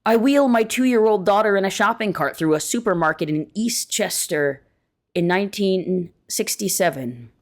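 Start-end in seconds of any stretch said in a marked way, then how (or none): none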